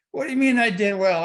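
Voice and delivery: a retold, half-mock cry of disbelief; incredulous protest. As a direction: protest that you lost, not won.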